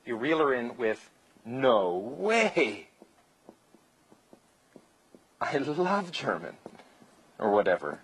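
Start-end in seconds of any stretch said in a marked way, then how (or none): 1.4-2.9 drawn-out, emphatic mock amazement; amazed disbelief. Shown as a demonstration. Coach demonstrating the reel-in line, mock amazed. 5.4-6.6 slow, stretched-out gushing enthusiasm; mock gushing. Shown as a demonstration. Coach reeling her in over her nationality, mock gushing. Demonstrating gushing reel-in line.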